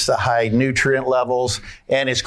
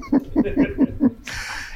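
delighted laugh; 3 dB quieter than usual. Real, not acted. Laughing after the punchline.

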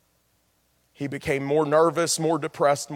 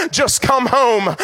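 slow plea; 7 semitones higher, 7 dB louder, much slower than usual. Acted. First plea, drawn out.

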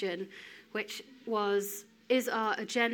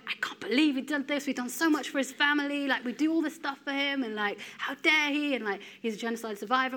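higher, mildly raised retold outrage; a touch of retold anger, higher in pitch; angry rant; 5 semitones higher, 4 dB louder than usual. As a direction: vent the old indignation again. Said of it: "I was so angry".

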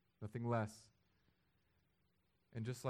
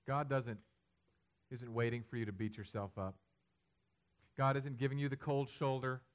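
normal talking voice

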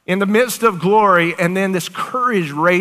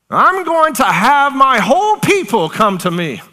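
the Lord speaking; 6 semitones higher, 4 dB louder than usual. Acted. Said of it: "he's like".

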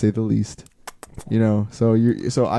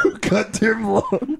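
high, slow exaggeration; drawn-out mock groan; 9 semitones higher, much slower than usual. Joking about time with teacher, drawn-out high voice. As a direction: stretch it out, pitched up. Mock repeat of own complaint.

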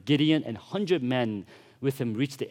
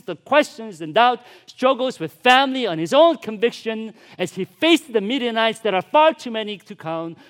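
loud, higher rising sermon climax; preaching; 5 semitones higher, 7 dB louder than usual. Acted. Preacher tells church of Gideon's calling, at full preaching force. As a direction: build the church up toward the point.

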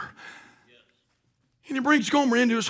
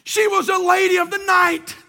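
full-force preaching; 5 semitones higher than usual. Acted. Pastor exalting the husband's mercy at full preach. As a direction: preach it to the rafters.

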